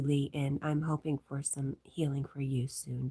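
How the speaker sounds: hushed ASMR-like murmur; whisper. Real, not acted. Streamer whispers chat messages to viewers.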